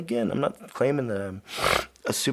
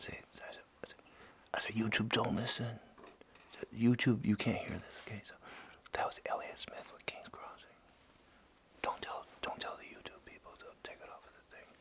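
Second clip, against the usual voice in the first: hushed unvoiced whisper so YouTube won't hear; 4 semitones lower, 15 dB quieter than usual. Host hiding the song from YouTube, whispering. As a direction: whisper it like a secret from the algorithm. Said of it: "Maybe if I say it real quiet".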